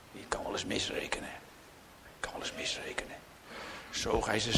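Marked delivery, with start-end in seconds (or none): 0.1-3.2 hushed, fast whispered warning; urgent whispered caution. Real, not acted. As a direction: drop to a whisper and let it sink in.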